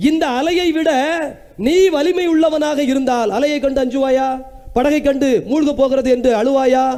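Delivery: high, driving preacher's voice, each line pushed up; the Lord's promise thundered from the pulpit; fiery preaching. Acted. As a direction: preach it with rising fervour. Preaching at full force.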